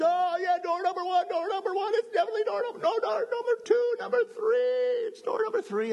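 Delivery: game show crowd shouting at the contestant; excited crowd. Acted. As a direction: yell out door choices like a frantic audience. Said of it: "the crowd goes".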